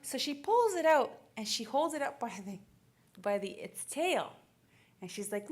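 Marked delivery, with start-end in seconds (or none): none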